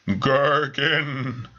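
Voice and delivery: a hint of an eerie ghost calling a name; lightly spooky summons; ghostly voice. Ghost calling Mister Gherkin, lightly spooky. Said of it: "said the voice".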